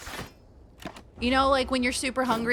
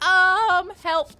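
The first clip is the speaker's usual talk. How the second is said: mock panic; 10 semitones higher, 9 dB louder than usual. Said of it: "I was like".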